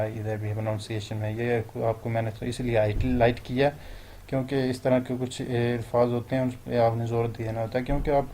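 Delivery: usual speaking voice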